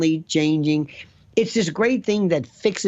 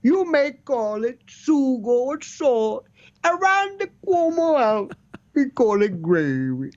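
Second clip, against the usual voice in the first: put-on Andrew Cuomo accent; 6 semitones higher than usual. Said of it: "So the next day he came out".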